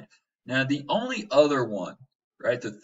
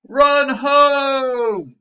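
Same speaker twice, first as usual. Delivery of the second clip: shouted high and loud; screamed; 13 semitones higher, 12 dB louder than usual. Acted. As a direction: yell it like a panicked warning. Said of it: "Jack screamed".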